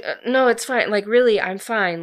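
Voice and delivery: acting out a too-quick "I'm fine"; insistent brush-off. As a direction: wave the concern away emphatically. Acted brushing off of his concern.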